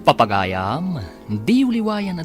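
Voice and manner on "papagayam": ordinary delivery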